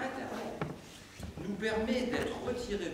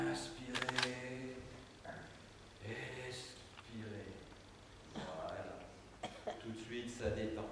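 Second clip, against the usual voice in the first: slow, soft soothing breathing coach; calming breathing guide; 7 semitones lower, 8 dB quieter than usual. Acted. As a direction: talk them down gently and slowly.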